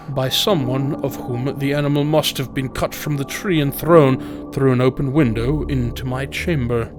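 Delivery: ordinary delivery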